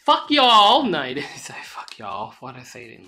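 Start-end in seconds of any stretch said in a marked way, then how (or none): 0.0-0.8 loud, high Southern American drawl; exaggerated Southern American twang, pitched up; weird Southern American accent. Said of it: "a weird Southern American accent". 1.6-2.3 low, soft Southern American echo; muted Southern American accent, half to himself. Acted. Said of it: "a weird Southern American accent".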